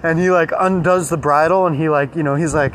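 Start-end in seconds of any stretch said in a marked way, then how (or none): none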